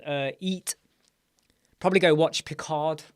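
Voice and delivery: usual speaking voice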